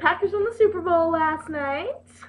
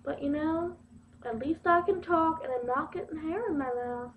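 ordinary delivery

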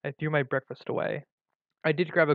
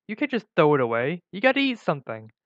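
loud, insistent protest; crying out; 2 semitones higher, 6 dB louder than usual. A narrator's reading. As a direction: urge it forcefully, raised voice. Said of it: "Robin cried out in response".

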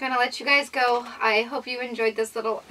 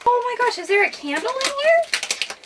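high, excited sudden question; surprised; 11 semitones higher, 3 dB louder than usual. Real, not acted. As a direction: perk up at a sudden smell. Surprised at smell.

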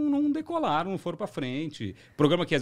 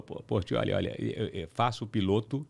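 lightly voiced impression of an older TV boss; affectionate light imitation; imitating the boss; 4 semitones lower than usual. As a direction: slip lightly into the boss's halting patter. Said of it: "ele imita igualzinho".

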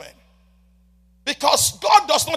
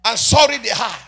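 loud, ringing preacher's cry over the music; thundering sermon delivery; full-force preaching; 6 semitones higher, 7 dB louder than usual. Acted. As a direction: preach it out to the back row.

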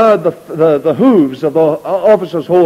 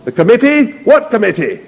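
incredulous general; 6 semitones higher than usual. Acted. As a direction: spit back the word in disbelief.

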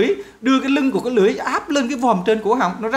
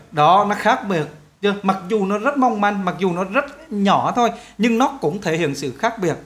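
ordinary talk, nothing marked

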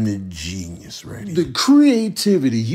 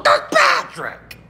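high calling out; slower than usual. Real, not acted.

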